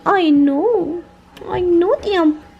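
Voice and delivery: high, slow soothing coo; high tender pet voice. Real, not acted. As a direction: comfort the whining dog. Comforting the crying dog.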